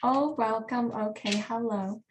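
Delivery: normal talking voice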